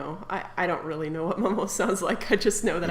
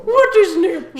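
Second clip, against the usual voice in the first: put-on high, loud whiny accent; whiny French lady; 10 semitones higher, 11 dB louder than usual. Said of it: "her to sound quite that, like, whiny".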